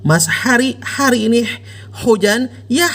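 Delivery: Hari mit schwerem gepresstem H; drück jedes H übertrieben heraus; übertriebenes H. Shown as a demonstration. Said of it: "Tidak juga pakai H".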